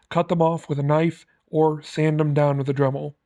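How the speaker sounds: usual speaking voice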